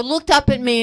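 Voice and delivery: usual speaking voice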